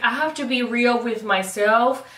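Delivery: ordinary talk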